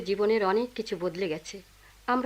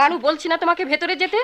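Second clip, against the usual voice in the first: high, raised-voice maternal order; sharp scolding; 7 semitones higher, 4 dB louder than usual. Acted. Mother ordering daughter inside, sharp scolding. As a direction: scold her out of the room.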